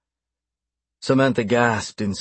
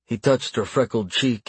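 ordinary delivery, nothing marked

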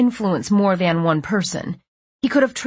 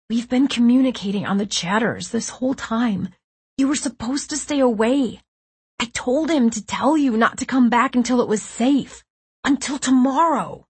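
distressed protest; 5 semitones higher than usual. A narrator's reading. Nico protests the early rescue.

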